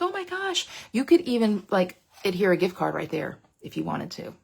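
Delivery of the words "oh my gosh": gushing. Retold or imitated. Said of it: "They're like".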